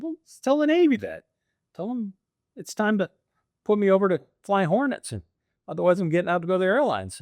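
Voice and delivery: high, fast, excited advice; urging a friend to push the Navy for Hornets; animated retelling. Retold or imitated. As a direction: fire it off eagerly, building speed at the end.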